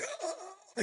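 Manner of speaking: strained high gasping grunts, soft; strangled gasps while clutching the throat; imitated choking. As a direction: mimic choking without words. Grabbing throat, can't speak.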